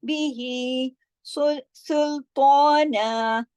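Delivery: सुल्ताना very slow, सीन hissed, ता long; slow recitation. Shown as a demonstration. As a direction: draw out सुल्ताना with whistling s. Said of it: "सीन की आवाज में सीटी की सी sound". (सुल्ताना धीमी तिलावत, सीन में सीटी)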